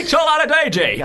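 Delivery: high, loud reality-TV announcer; X Factor voice. Acted. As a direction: belt the name like a talent show host. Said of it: "X Factor voice".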